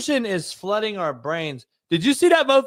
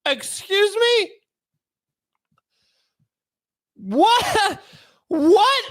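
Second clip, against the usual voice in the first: high-pitched, stunned disbelief, long gaps between; acted shock, each word a higher-pitched yelp; aghast; 10 semitones higher, much slower than usual. Client meeting his public defender, hammed-up high aghast disbelief.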